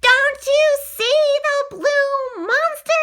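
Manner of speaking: high, loud, stretched-out yell; exasperated yell. A narrator's reading. Boy nobody believes, exasperated high yell. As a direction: yell slowly so they finally see it. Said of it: "I yell".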